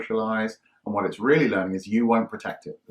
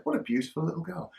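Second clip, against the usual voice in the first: cooing stranger; 7 semitones higher, 6 dB quieter than usual. Retold or imitated. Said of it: "and said".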